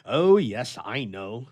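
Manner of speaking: dry, sarcastic reply; a touch of sarcasm. A narrator's reading. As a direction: brush the point off with a mild sneer. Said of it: "sarcastically replied the bachelor".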